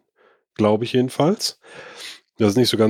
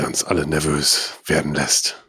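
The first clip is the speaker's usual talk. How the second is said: lower, hammed-up gravelly charm; mock raspy deep voice; 8 semitones lower than usual. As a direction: drop the voice low, a playful husky rumble. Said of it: "kratzigen Charme im Ton der Stimme".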